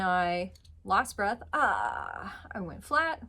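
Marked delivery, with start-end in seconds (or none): none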